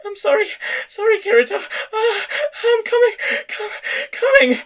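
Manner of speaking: overwhelmed, drawing out the last word; tearful high cry. A narrator's reading. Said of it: "drawing out the last part".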